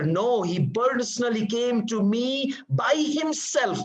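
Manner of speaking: "personally" and "by himself" pitched high and drawn out; slow, high insistence on "by himself"; emphasis on himself. Shown as a demonstration. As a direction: stretch "by himself" to drive it home. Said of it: "Emphasizing that the guy came by himself".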